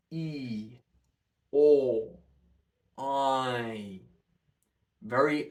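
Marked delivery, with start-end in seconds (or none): 0.1-4.0 wide open mouth on each vowel; exaggerated vowel shapes. Shown as a demonstration. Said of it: "Try and make these shapes".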